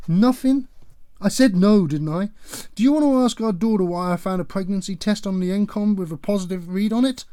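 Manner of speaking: loud, high, accusing; mother shouting to expose her daughter; indignant shouting. Acted. Wife exposes daughter's secret.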